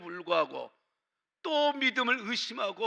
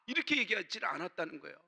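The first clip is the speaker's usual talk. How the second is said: usual speaking voice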